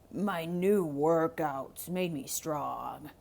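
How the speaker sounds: low, slow bragging wolf. Acted. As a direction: boast like a wolf. Wolf replies.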